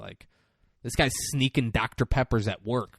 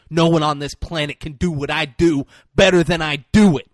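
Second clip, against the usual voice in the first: loud, higher-pitched mock tirade; angry boasting; 4 semitones higher, 8 dB louder than usual. Recapping Renner's rant at his boss, angry boasting. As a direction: boast forcefully, punching the words out.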